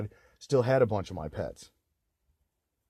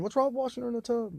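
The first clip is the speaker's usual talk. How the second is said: much higher, pleading repeat; indignant repeat; 13 semitones higher than usual. Real, not acted. Speaker to parents repeating the question, high disbelief. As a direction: ask again, pitched way up in disbelief. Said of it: "That's my question".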